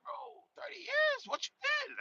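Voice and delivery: high-pitched stunned disbelief; incredulous. Retold or imitated. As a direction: shoot up high in shock. I'm just looking at him like.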